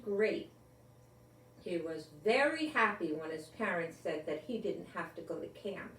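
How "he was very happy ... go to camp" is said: plain reading voice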